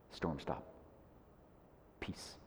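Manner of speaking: very soft, low calm command; hushed command. Retold or imitated. Jesus speaking to the storm, very soft command.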